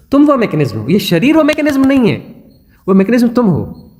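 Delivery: ordinary talk, nothing marked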